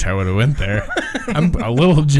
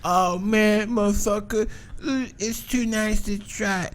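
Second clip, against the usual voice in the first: high, soft druggie voice, slowing at the end; tweaker impression; 8 semitones higher, 7 dB quieter than usual. Mimicking tweakers giving up on the nice house, high soft voice. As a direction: put on a hushed, high-pitched tweaker. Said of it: "obviously they're like".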